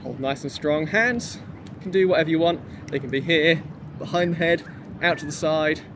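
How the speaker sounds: ordinary talk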